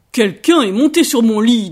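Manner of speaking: very loud, booming bear; Papa Bear's big voice. A narrator's reading. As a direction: roar it as an indignant papa bear. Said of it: "dit papa ours d'une très grosse voix". (très grosse voix de papa ours, tonnante)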